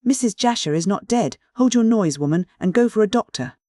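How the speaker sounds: stern command. A narrator's reading. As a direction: bark the order over her screams. Ordering the hysterical maid to hush.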